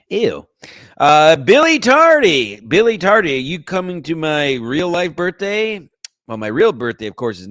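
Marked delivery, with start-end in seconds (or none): 1.0-5.9 calling out. Real, not acted. Calling out to a chat user.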